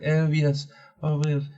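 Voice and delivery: lightly voiced upper-class lady; posh lady voice. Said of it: "she's very posh and she's like".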